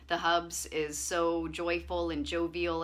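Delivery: ordinary talk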